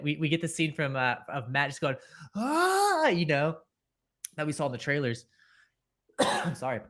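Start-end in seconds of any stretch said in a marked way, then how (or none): 2.3-3.1 high-pitched mock scream at normal volume; imitated scream. Host imitating Matt losing it, high mock scream. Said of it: "they're both just losing their shit".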